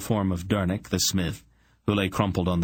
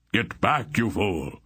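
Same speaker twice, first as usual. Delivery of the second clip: shouting; 3 semitones higher than usual. A narrator's reading. Sorcerer orders the attacker away, mild shout. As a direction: warn him off with a contained shout. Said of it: "Zadar was shouting".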